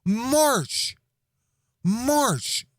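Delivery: put-on high, earnest little-girl voice; serious toddler voice. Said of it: "she looks at me dead serious".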